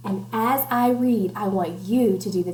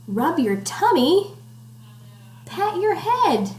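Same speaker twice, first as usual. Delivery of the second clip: higher, louder sing-song; lively rhyme reading; 6 semitones higher, 4 dB louder, slower than usual. Acted. Teacher reading workout rhyme, bright sing-song. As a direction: pump up the kids with the rhyme. Reading picture book to children.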